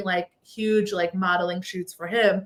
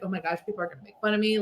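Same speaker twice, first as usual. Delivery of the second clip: anxious thought; 3 semitones lower, 7 dB quieter, much faster than usual. Retold or imitated. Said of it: "I was like".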